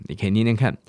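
ordinary delivery

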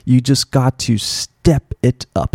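slow, carefully spoken English phrase; slow clear demo. Shown as a demonstration. Teacher modelling the phrase slowly for students. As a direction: spell out each word slowly for learners. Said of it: "这是一个很不错的片语".

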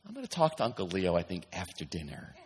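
soft, unbothered aside; calm and unshaken, trailing into a laugh. Retold or imitated. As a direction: say it softly, unfazed. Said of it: "you're like".

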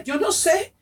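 high, impassioned, as if crying; retold tearful declaration. She confesses her new happiness to her boyfriend, tearfully impassioned. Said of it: "Y lloraba y lloraba y le decía".

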